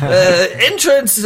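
high, stretched barker's call; drawn-out fairground patter; old-timey carnival barker. Acted. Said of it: "like an old-timey carnival barker".

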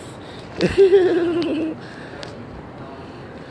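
long squealing giggle way up; squealing cackle; high shrieking laugh. Real, not acted.

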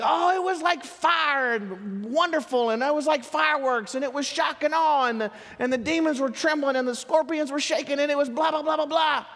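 high, breathless, gushing over the mission trip; excited kids. Retold or imitated. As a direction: gush like kids after something cool. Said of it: "they were so excited".

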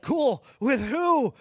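mock teen reply. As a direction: bark it out like a surprised kid. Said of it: "And Charlie's like".

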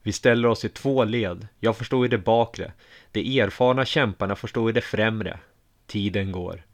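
usual speaking voice

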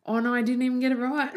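high, fast, laughing realisation; laughing dismay. Real, not acted. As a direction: blurt it out fast through rising laughter. Laughs at own mistake.

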